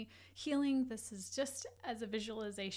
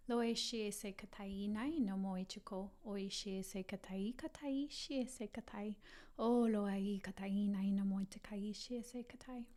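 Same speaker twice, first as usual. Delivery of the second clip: fluid ritual chant-like speech in invented syllables; light language. Acted. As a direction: let strange syllables flow in a continuous stream. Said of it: "doing any kind of light language work".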